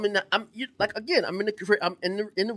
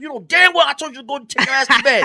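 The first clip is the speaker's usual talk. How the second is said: mock scolding; 6 semitones higher, 5 dB louder than usual. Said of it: "I said".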